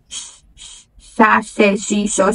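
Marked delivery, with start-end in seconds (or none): 0.1-0.9 long hushing sh; unvoiced sh. Shown as a demonstration. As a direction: hiss out a soft sh. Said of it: "em português é sh". (som de sh sussurrado)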